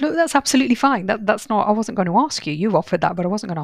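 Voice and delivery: emphatic reassurance. Retold or imitated. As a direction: rush to reassure him it's fine. Therapist reassuring anxious client.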